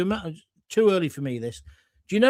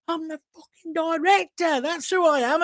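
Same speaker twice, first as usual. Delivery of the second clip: angry director; 10 semitones higher than usual. Retold or imitated. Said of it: "He goes".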